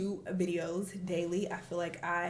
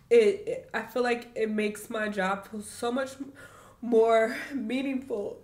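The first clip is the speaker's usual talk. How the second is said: real crying, halting with long pauses; choked up with gratitude; tearful; 3 semitones higher than usual. Real, not acted. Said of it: "I said I wouldn't cry on camera".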